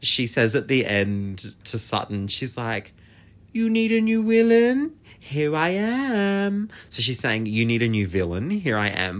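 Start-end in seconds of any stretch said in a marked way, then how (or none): 3.5-6.7 put-on high, loud European-accented villainess, ending drawn out; hammed-up European accent, slow on the last words; imitated European accent. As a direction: announce yourself grandly in a mock European accent. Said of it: "she's obviously got this accent".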